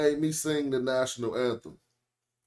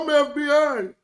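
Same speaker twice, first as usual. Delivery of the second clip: mock claim; 12 semitones higher, 7 dB louder than usual. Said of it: "Now y'all gonna be like".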